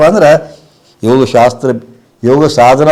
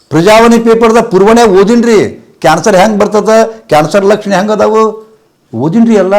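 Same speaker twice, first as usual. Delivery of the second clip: high, louder woman's voice, insistent; imitated fearful woman; 9 semitones higher, 5 dB louder than usual. Guru voicing the woman who fears cancer: high, insistent plea. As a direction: play the panicked disciple pleading. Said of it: "ಅಂದ್ರು".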